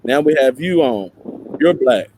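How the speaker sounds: ordinary talk, nothing marked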